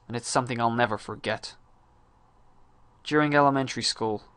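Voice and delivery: ordinary delivery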